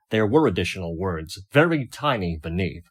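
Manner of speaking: ordinary delivery, nothing marked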